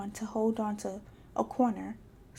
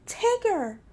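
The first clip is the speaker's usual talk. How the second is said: crying out; 10 semitones higher, 4 dB louder than usual. A narrator's reading. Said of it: "Rabbit cried".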